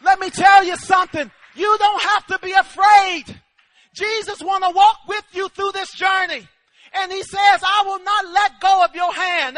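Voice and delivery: loud, fervent exhortation; impassioned revival preacher; full-force preaching. Real, not acted. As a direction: drive each promise home.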